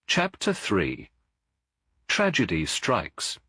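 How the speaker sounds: slow, measured chapter heading; chapter title read. A narrator's reading. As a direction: set the title apart, slowly and deliberately.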